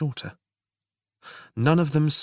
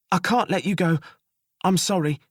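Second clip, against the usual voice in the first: high, firm sentence; jailer voice; 8 semitones higher, 4 dB louder than usual. Acted. Jailer telling the prisoner his fate, high. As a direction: say it high and regretful.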